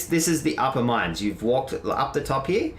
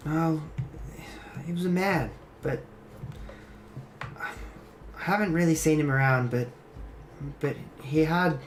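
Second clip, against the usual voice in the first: higher voice with long uncertain pauses; unsure, halting higher-pitched character voice; hesitant, troubled character; 5 semitones higher, 4 dB quieter than usual. Acted. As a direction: falter through an uneasy explanation, pitched up. Plays Nezriel explaining herself.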